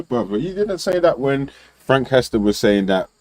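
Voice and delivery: ordinary talk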